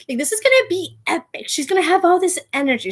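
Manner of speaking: quick giddy hype; excited. Real, not acted. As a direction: rush it out like you can't wait. Hyping up the halftime show.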